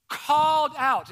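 rising to a loud call; called out loudly. Retold or imitated. Preacher reading the verse, rising to a loud call. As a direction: build from calm to a loud cry. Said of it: "this word call is the word to cry out loudly".

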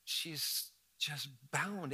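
soft, slow murmured prayer; young pastor quietly praying after the hospital visit; quiet helpless prayer. Retold or imitated. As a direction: breathe it to God, stunned and helpless. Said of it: "there and like".

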